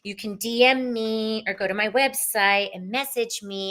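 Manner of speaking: ordinary talk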